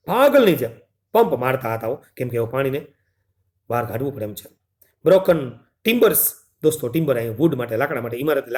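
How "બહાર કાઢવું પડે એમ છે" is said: normal talking voice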